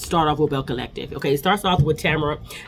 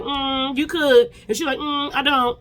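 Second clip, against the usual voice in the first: much higher, sassy mock exchange; sassy retort; 10 semitones higher, slower than usual. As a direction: toss it off with a high knowing "mm". Shantae and Tamara trade sassy retorts.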